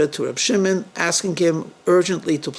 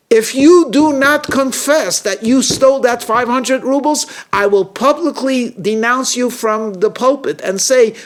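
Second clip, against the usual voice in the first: loud, high, thundering denunciation; shouting; 8 semitones higher, 6 dB louder, slower than usual. A narrator's reading. Rabbi denouncing Shimon as a thief, shouting. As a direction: shout it slowly and forcefully, then ease slightly into a stern threat. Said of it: "shouted at Shimon".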